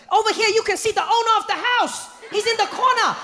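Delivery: the tour guide welcoming the group, way too happy; overly happy tour guide. Acted. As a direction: greet tourists way too cheerfully. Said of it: "tour guide".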